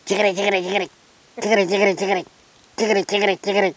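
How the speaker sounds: quick high chattering triplets; willy wagtail call. Retold or imitated. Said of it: "He go like this".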